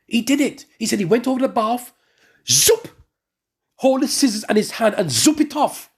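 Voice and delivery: ordinary talk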